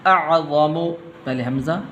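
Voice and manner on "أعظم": slow melodic Quranic chant; Quran recitation. Shown as a demonstration. Teacher reciting the verse to students, measured chant. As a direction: recite the verse slowly with tajweed.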